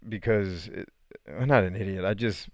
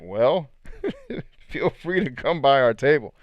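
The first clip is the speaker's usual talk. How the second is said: amused retold reply; 5 semitones higher, 3 dB louder than usual. Lady answers the woman wanting her man, laughing through it. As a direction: laugh breaking into a higher, louder invitation.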